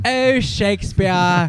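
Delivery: slow, high, loud posh British mock. Friends mocking a British actress, hammed-up British accent. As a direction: drawl it like a grand British thespian.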